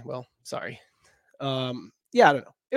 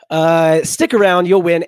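loud; 3 semitones higher, 11 dB louder, slower than usual. Real, not acted. Encouraging the chat.

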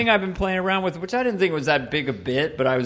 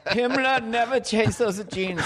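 high, slow camp dictator; charming Hitler; 8 semitones higher, slower than usual. Acted. Hitler fussing over Himmler's clothes, high camp voice. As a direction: draw it out in a high mock-fey voice. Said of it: "it's just charming Hitler".

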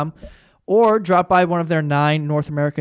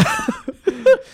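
high laugh. Real, not acted. Laughs after the bit.

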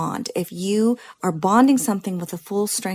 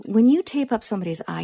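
usual speaking voice

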